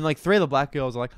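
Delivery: ordinary talk, nothing marked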